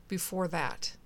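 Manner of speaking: flat, falling delivery. A narrator's reading. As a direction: repeat it flatly, no lift at the end. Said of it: "Chris said flatly".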